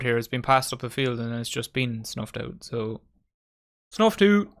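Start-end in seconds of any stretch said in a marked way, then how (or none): 3.9-4.5 loud, high mock-commentator cry; catchphrase call-out. Streamer teased about a catchphrase, playful loud call. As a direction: belt it out like a running joke.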